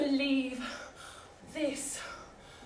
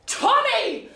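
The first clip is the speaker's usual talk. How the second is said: indignant cry; 10 semitones higher, 9 dB louder than usual. Acted. Indignant heroine exclaims.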